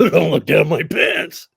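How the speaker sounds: loud, drawn-out comic warning; retold mock yell. Comic recalling what he told the cop, loud mock-panicked yell. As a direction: blurt it out slowly and loudly for the laugh.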